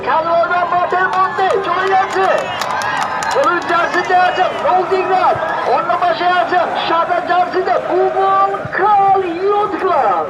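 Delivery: ordinary talk, nothing marked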